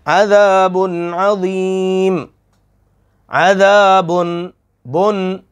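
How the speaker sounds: slow chant of अज़ाबुन Azeem, no ghunna; teacher models tajweed; slow Quranic recitation. Shown as a demonstration. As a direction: recite slowly in tajweed style. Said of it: "ये नून तनवीन है".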